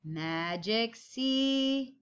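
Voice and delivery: drawn-out slow Magic C; slow tracing call. Shown as a demonstration. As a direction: stretch it slowly while tracing. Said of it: "everybody get your pencil ready".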